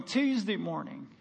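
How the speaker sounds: normal talking voice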